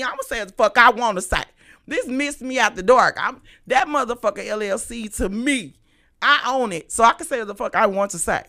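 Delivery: loud, emphatic declaration; forceful, unapologetic ranting; defiant. Real, not acted. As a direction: speak up boldly and own it. Defiantly claims right to speak freely.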